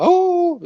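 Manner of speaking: short high loud howl; wolf howl. Shown as a demonstration. Lecturer imitating a wolf-howl tic, loud high howl. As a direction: yelp it like a wolf. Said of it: "aullidos tipo lobo".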